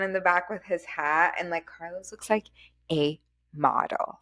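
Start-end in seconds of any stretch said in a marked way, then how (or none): 2.9-4.2 slow, set-apart words "a model"; emphatic. Real, not acted. Co-host admiring how Carlos looks, emphatic. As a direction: give "a model" weight, word by word. Drawn-out praise of driver's looks.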